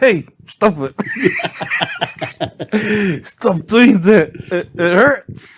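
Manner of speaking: hammed-up emotional dad begging him to stop, laughing; mock choked-up dad. Son retelling his dad's teary reaction, laughing and loud. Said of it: "getting all choked up".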